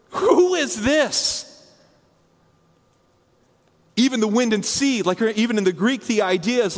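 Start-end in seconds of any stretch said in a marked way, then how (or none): 0.1-5.0 higher, drawn-out amazed question; awestruck question. Acted. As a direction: ask it in stunned awe. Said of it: "they literally said to one another".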